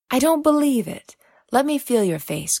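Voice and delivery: high, loud cry of disbelief; startled disbelief. A narrator's reading. Blind Nanaline reaching for Uma, high and loud disbelief. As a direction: she can't believe her ears.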